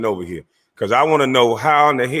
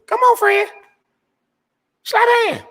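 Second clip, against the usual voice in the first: much higher, louder squeaky invite; mock falsetto; 4 dB louder than usual. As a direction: tease him in a high voice. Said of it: "mock invitation in falsetto".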